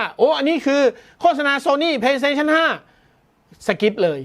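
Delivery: high, slow, eager viewer naming the ad; viewer calling out. Pretend. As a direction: play a viewer who spots the ad and skips it. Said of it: "แต่ถ้าคุณบอกว่า".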